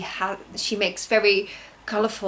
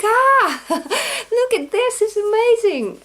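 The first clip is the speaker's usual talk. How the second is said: high, loud laughing exclamation; reliving the unboxing, laughing and shrieking; ecstatic laughing cry; 12 semitones higher, 7 dB louder, slower than usual. Retold or imitated. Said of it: "Oh, I was like".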